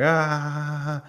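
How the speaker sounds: short high mock yell; a quick hammed-up shriek; mock scream. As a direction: yelp of fright, played for laughs. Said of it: "And he screams".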